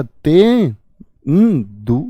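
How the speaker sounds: ते, न, दु each held separately; syllable by syllable. Shown as a demonstration. Teacher building the word for students, syllable by syllable. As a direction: sound out ते, न, दु slowly, piece by piece. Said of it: "देखो".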